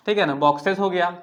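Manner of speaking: ordinary delivery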